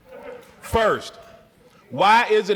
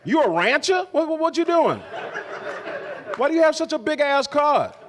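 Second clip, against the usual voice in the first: mock baffled driver; 8 semitones higher than usual. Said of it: "It's like".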